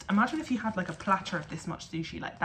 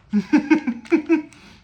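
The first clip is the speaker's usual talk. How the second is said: delighted laugh; 8 semitones higher, 11 dB louder than usual. Real, not acted. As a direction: burst of happy laughter. Tasting the food.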